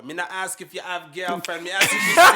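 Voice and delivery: high, loud Jamaican woman's voice, teasing; hammed-up Jamaican accent, pitch climbing to a squeal. Jamaican sister correcting the nurse, in a loud high Jamaican accent. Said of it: "She's like".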